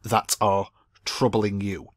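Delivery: ordinary talk, nothing marked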